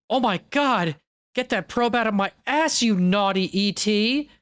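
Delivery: high, campy fake protest; mock delighted protest. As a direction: play a coy abductee secretly enjoying it. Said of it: "maybe some of them liked it".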